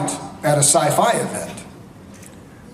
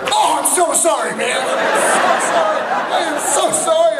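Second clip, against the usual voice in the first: frantic apology; 11 semitones higher than usual. Retold or imitated. Producer grovels to the actor after the joke: high frantic apology.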